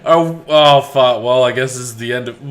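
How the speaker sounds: normal talking voice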